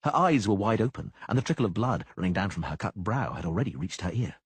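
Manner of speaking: normal talking voice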